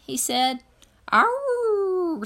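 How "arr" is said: high, loud mournful dog howl. A narrator's reading. Dog howling outside the church during the sermon. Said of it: "said Winn-Dixie".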